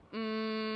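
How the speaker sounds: a steady closed-mouth hum on the exhale; humming bee hum. Shown as a demonstration. Teacher demonstrates the humming bee breath, steady hum. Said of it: "you are humming".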